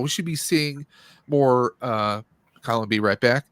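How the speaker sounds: ordinary talk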